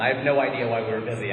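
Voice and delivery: ordinary talk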